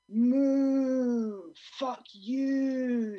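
loud, high, bellowed moo; mock cow mooing back rudely. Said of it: "says the cow".

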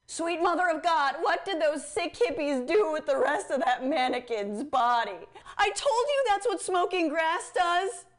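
mock horrified old square. Mock horror at the campaign ad.

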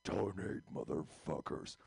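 low, deep repeat of the demand. Shown as a demonstration. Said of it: "By the way, donate, motherfuckers".

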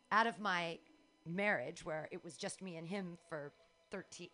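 ordinary talk, nothing marked

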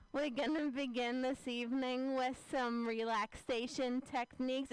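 hint of nasal Fran Drescher voice. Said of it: "my inner monologue is Fran Drescher".

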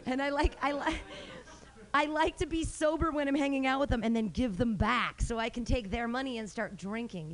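normal talking voice

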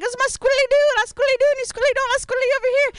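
very high squeaky tripping voice; squiddly dude voice. Retold or imitated. Friend tripping on ayahuasca, chanting in a very high squeaky voice.

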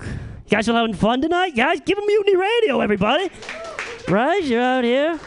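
high excited crowd call; hyping the crowd. Real, not acted. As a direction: pump up the room.

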